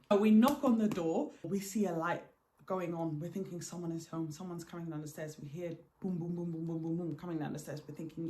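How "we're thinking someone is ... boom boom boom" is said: normal talking voice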